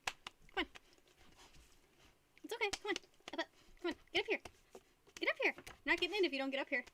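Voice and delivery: high coaxing call to the dog; gently urging the dog to jump up. Real, not acted. Owner coaxes her husky onto the bed, high and encouraging.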